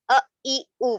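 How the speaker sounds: ا، ای، او cut off abruptly; choppy, very short ا، ای، او; jerked vowels. Shown as a demonstration. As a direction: jolt each of ا، ای، او as the mistake. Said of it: "jerk پیدا نہیں کریں گے".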